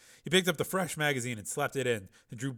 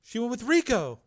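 put-on very high, loud pleading girl; terrified girl; 12 semitones higher, 6 dB louder than usual. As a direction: shriek in terror. Frightened girl.

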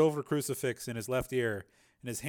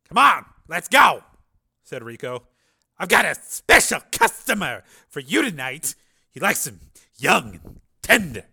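loud, higher-swooping pimp voice; sleazy pimp; 4 semitones higher, 11 dB louder than usual. Acted. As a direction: press the girl like a pushy, oily pimp.

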